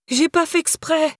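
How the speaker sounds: high-pitched, drawn-out cry of protest. A narrator's reading. Sacked employee calling to her boss through the window, crying out in protest. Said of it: "cria-t-elle à l'attention de Maya".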